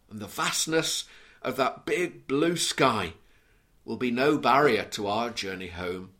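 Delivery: ordinary talk, nothing marked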